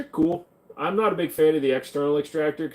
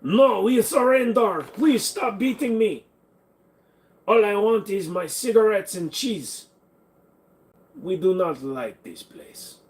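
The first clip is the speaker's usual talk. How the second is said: higher, hammed-up French pleading; mock French surrender; 7 semitones higher than usual. Mocking a beaten fighter as a surrendering Frenchman. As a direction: whine it like a cartoon Frenchman giving up.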